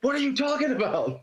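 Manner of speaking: high-pitched, laughing retort in mimicry; comic put-on disbelief; mock incredulous. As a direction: act out baffled protest, pitched well up, amused. Repeats the film's baffled comeback.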